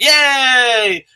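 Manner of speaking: high, bright little cheer; hammed-up yay; mock cheer. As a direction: cheer like it's a party. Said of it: "I'm like".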